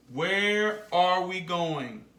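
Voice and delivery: "where are we going" slowly, word by word; slow model sentence. Shown as a demonstration. Teacher models a child's question to Mom, slow and spaced out. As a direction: stretch each word for learners to copy. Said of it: "Can you say it with me?".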